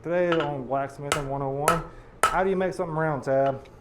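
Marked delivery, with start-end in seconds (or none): none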